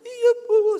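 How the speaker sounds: high, loud imitated wail; crying. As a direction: act out a woman bursting into tears.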